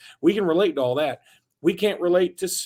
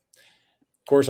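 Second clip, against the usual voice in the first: whisper; 3 semitones lower, 4 dB louder than usual. Acted. Aside before next ad.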